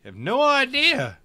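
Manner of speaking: mock villain boast. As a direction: gloat over the dumb raptor. Taunting the raptor, loud.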